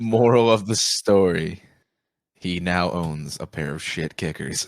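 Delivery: slow, deliberate mock moral; mock storyteller. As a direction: wrap it up like a fable's narrator.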